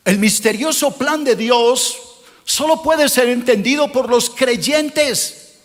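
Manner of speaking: usual speaking voice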